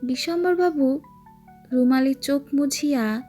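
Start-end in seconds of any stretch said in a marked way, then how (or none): none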